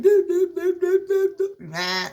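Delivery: high wailing blah blah, crying; mock sobbing blah. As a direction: wail nonsense syllables like a sobbing man. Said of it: "he's down there crying".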